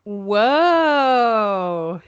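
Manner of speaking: long, loud drawn-out wow; amazed whoa. Real, not acted. Reacting to co-host's adjective fact, drawn-out amazement. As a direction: stretch it out, rising then falling, mind blown.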